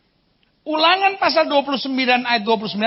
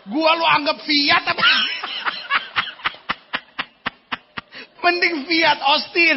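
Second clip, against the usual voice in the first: mock offended shout; 6 semitones higher, slower than usual. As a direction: play the insulted wife. Acting an offended wife. (teriakan tersinggung yang dibuat-buat)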